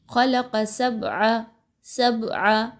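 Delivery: سَبْعَ with a bouncing echo on the ba; qalqalah on ba. Shown as a demonstration. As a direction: let the ba of سَبْعَ shake slightly. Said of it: "تھوڑا سا آواز کو ہلا کر پڑھنا ہے". (سَبْعَ میں با پر قلقلہ)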